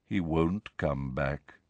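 low, slow, soft pronouncement; mournful. A narrator's reading. Ghost tells Harry Sirius is gone, mournful. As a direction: let it sink gently and gravely. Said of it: "looked mournfully at Harry".

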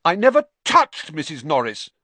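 said loudly. A narrator's reading. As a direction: protest loudly. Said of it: "Harry said loudly".

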